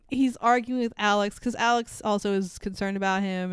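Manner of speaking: normal talking voice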